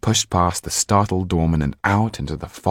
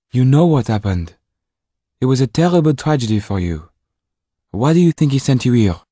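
put-on higher, louder lady pressing the boy; Mrs Laxton's voice; 7 semitones higher, 6 dB louder than usual. A narrator's reading. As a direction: push him gently but firmly.

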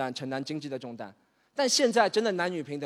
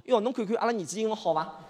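1 Shanghainese mom; 3 semitones higher than usual. Acted. Mother speaks Shanghainese to aunt.

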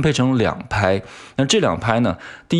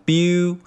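beau held long on a high pitch; raised pitch; 9 semitones higher than usual. Shown as a demonstration. Teacher shows the stressed syllable's pitch, raised high. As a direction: lift beau up high and stretch it. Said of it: "所以说我们把它音调抬高".

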